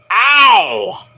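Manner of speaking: drawn-out sympathetic "ow"; mock pain cry. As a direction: wince aloud at a nasty hit. Winces at the power bomb.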